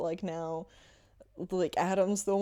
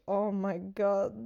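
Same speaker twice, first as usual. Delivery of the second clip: ordinary talk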